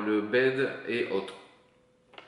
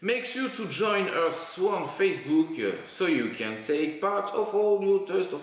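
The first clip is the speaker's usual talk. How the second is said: slow, higher, hammed-up French-accented English; showy English accent; 5 semitones higher, slower than usual. Shown as a demonstration. Vlogger reading out a Facebook invitation in showy English accent. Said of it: "C'est un super accent anglais".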